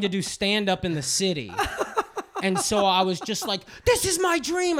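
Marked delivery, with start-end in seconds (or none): 3.9-4.8 indignant declaration. Retold or imitated. Retelling his outburst at dad.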